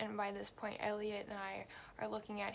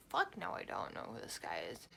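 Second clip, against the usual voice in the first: lightly voiced brother; 9 semitones lower than usual. Brother answers, voiced by her.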